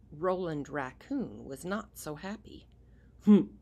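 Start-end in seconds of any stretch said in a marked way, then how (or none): 3.3-3.5 gruff harrumph. Acted. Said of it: "he said to himself".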